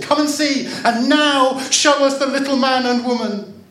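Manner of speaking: put-on very high, loud childish demand; calling out. A narrator's reading. Children demanding the girl show them, calling out. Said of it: "the children called".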